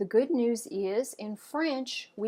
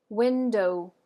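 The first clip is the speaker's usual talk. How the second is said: "window" with WIN louder than the ending; first-syllable stress; 6 dB louder than usual. Shown as a demonstration. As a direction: lean on the opening syllable of "window". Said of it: "the first syllable is slightly emphasized".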